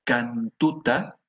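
ordinary talk

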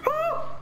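sudden high shriek; scream. Real, not acted. Player caught by monster, real shriek. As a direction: scream of fright. Jump scare.

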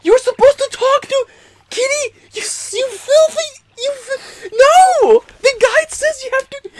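squeaky, near-crying protest; high-pitched exasperation. Pretend. Player scolds Kitty for skipping the guide, squealing exasperation. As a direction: plead in a very high, strained squeal. Thwarted player fusses at partner.

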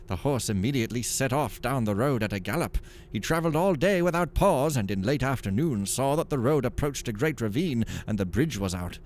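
lively storytelling read; story narration. A narrator's reading. Streamer reading an in-game joke book aloud, lively narration. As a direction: tell it like a fireside joke.

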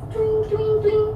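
high, sing-song twing-twing; playful twing noise. Shown as a demonstration. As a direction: make a high little electronic twang with the voice. Voices a little sound effect.

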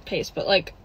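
normal talking voice

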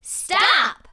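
loud, high, abrupt command; commanding stop. A narrator's reading. Period bringing the sentence to an end, loud command. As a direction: call a sudden halt. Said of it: "Period brought each sentence to a tidy end".

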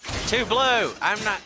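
high, loud outburst; yelling. Real, not acted. Gamer yelling at a blue enemy, high loud outburst.

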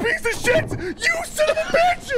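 full panic scream at a friend; panicking gamer. Real, not acted. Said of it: "I screamed so hard".

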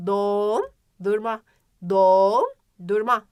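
"Dondurma" with a long held "don", pause, quick "durma"; stretched middle O. Shown as a demonstration. Teacher asking where O falls, long o in "Dondurma". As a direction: hold the o of "Dondurma", then finish quickly. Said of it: "Tabii ki de ortasında".

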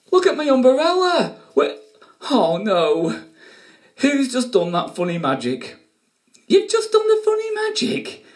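high, loud hammed-up dismay; mock shock. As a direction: overplay the shock at the umbrella. Mock shock at broken umbrella.

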